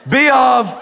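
loud high prompt with a laugh; calling out again. Shown as a demonstration. Pastor cueing the next word to the crowd, laughing and loud. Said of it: "No, the next part".

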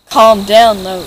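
sudden loud yell; yelled calm down. Acted. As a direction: blast it out at the victim. Robber yelling at panicking victim.

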